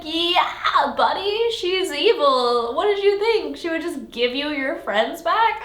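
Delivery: exasperated scolding. Retold or imitated. Reader scolds the heroine for trusting the villain, exasperated. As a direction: talk down to a gullible friend.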